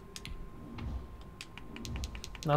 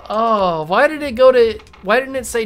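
louder, higher frustrated cry; exasperated outburst; 5 semitones higher, 5 dB louder than usual. Real, not acted. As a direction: complain at the screen. Game misclick outburst.

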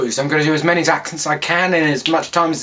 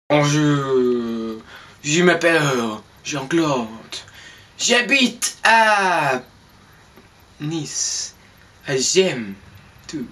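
French character; 2 semitones lower, much slower than usual. Acted. Plays a Frenchman introducing himself in French.

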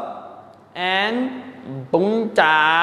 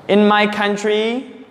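ordinary talk, nothing marked

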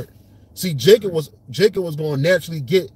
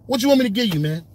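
much higher, fast street-talk Isaac; modern Isaac paraphrase; 10 semitones higher, faster than usual. Retold or imitated. Isaac tells Esau he has nothing left, higher and fast. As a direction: blurt it out like a flustered dad. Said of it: "It was like".

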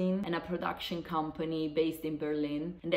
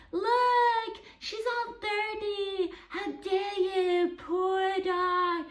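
much higher, nasal-free shrill-free mock lady voice, slow at first; mocking the angry neighbour; 3 dB louder, much slower than usual. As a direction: ape the outraged woman at the window high and fussy. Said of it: "And she was like".

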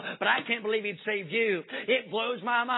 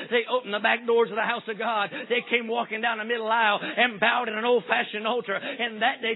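ordinary talk

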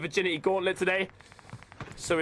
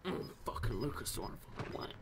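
muttered under breath; 8 semitones lower, 13 dB quieter than usual. Real, not acted. Muttered aside.

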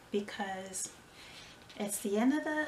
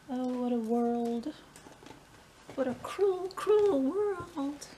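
melodramatic higher wail, louder; mock tragic; 4 semitones higher, 4 dB louder, slower than usual. Vlogger mourning no orders, hammed-up lament. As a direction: ham up the despair of no orders.

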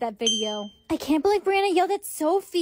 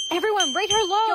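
higher, called-out command; raised-pitch public order; rallying cry; 6 semitones higher than usual. Acted. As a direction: call the crowd to turn on her. Rallying crowd against her.

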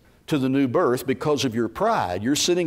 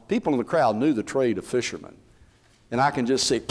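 ordinary talk

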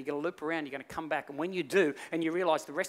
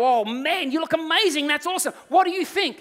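high, loud gushing amazement; convincing flattery; 12 semitones higher, 10 dB louder than usual. Acted. Tailors sell the king his invisible suit, convincing gushing flattery. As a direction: lay on loud, excited praise. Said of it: "they're so convincing, they go".

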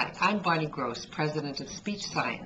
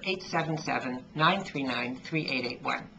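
usual speaking voice

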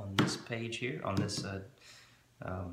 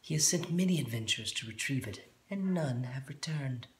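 gnome voice; 5 semitones higher than usual. Acted. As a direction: lean in and murmur like the gnome. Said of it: "the gnome says".

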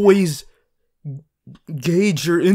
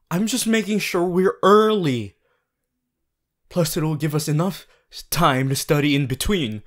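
normal talking voice

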